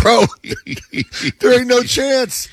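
high mock-incredulous dad voice, slowed. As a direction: scoff at the kid's claim, drawn out. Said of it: "I'm like".

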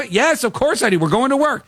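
high, fast and offended insistence; indignant Casey. Retold or imitated. Casey insists to the cops, high-pitched and indignant. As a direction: act insulted that anyone doubts you.

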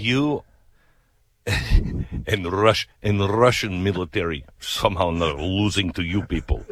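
imitated Russian accent, amused; mock Russian nurse. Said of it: "'Cause she was like".

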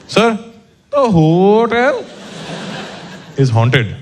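put-on Indian accent. Bellman voiced, ghost news.

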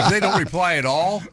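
normal talking voice

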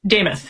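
Demuth as DAY-muth, stress first; correct pronunciation. Shown as a demonstration. Guest telling host the candidate's name, clear DAY-muth for Demuth. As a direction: say Demuth with a long "day" opening. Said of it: "How does she pronounce her name?".

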